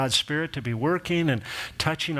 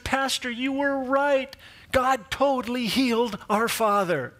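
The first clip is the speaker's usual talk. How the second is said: high, slow, bright sincere thanks; family thanking the pastor, elated; rejoicing family; 9 semitones higher, slower than usual. Retold or imitated. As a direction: sing out the good news gratefully. Family rejoicing after death.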